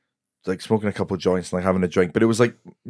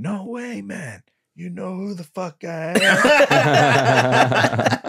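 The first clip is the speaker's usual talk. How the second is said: high, drawn-out impression heard from afar; Tom Stade; 10 semitones higher, much slower than usual. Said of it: "you doing an impression of him".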